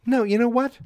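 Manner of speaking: high, loudening imitation of a father stopping everyone; mock-indignant dad cutting in; Howard objecting. Howard cutting in to stop the gift, rising indignation. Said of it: "and Howard's like".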